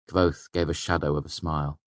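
plain reading voice

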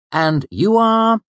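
high, loud, slow, syrupy false politeness; over-courteous. A narrator's reading. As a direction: lay on the fake courtesy. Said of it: "in an over-courteous tone".

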